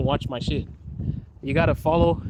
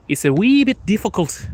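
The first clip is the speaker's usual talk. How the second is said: higher, lilting Irish accent; 5 semitones higher, 4 dB louder than usual. Shown as a demonstration. Streamer attempting Scottish, slipping into an Irish accent. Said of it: "I switched into Irish".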